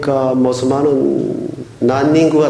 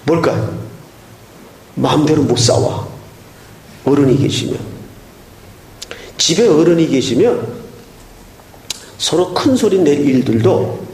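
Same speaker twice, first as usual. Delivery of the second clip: normal talking voice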